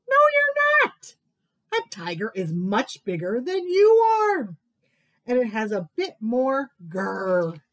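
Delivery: a bossy animal laughing off the mouse; scoffing animal voice. Acted.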